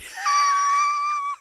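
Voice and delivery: shrieking burst of laughter. Real, not acted. Host losing it over a joke, shrieking laugh. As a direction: let out a high whooping laugh.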